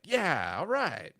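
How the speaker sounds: loud, high cheer of approval; happy reaction. Retold or imitated. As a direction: act out a thrilled moviegoer. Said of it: "happy, like".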